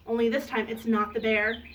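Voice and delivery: ordinary delivery, nothing marked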